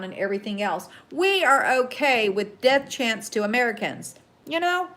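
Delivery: real indignant ranting; worked-up rant. Real, not acted. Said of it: "I get so worked up sometimes".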